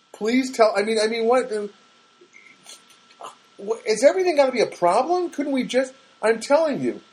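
high-pitched, exasperated protest; exasperated caller; irate. Retold or imitated. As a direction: plead your case, irritated and worked up. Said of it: "I get irate".

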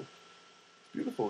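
It's beautiful whispered slowly, softly. Shown as a demonstration. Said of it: "repeated as a breathy aside".

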